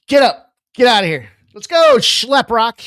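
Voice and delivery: boot him out of the chair, loud; movie boss yelling. Retold or imitated. Quoting Boiler Room's boss, a loud imitated shout. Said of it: "And he says".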